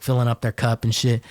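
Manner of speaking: usual speaking voice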